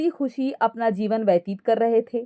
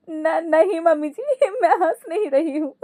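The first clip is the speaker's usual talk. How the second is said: laughing denial; 6 semitones higher than usual. Acted. Daughter-in-law denying to mother-in-law, laughing all the while. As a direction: deny it while audibly laughing. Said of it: "Ankita हंसते-हंसते बोली".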